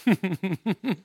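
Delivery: soft string of fake little laughs; mock pondering chuckle. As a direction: imitate someone chuckling as they keep you waiting. Mimics Jesus idly toying with sinner.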